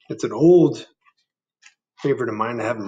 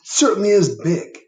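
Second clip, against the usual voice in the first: lightly voiced boy; 9 semitones higher, 5 dB louder than usual. Jim voiced in story.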